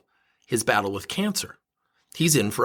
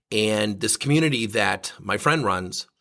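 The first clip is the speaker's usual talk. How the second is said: normal talking voice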